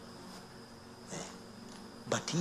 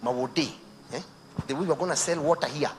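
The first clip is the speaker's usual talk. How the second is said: pushy salesman; 4 semitones higher, 8 dB louder than usual. Retold or imitated. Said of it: "they come and tell you".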